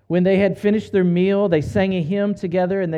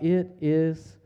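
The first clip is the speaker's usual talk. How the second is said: quiet, exhausted last words from the cross; spent final cry; 5 dB quieter, slower than usual. A narrator's reading. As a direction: let the words sink away, drained. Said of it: "he cried out".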